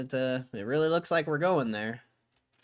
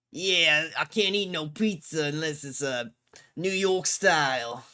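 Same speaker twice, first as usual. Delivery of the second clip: mock New York accent; 7 semitones higher, 7 dB louder than usual. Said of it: "I can't do it".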